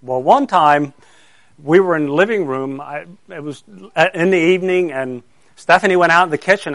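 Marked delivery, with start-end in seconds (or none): none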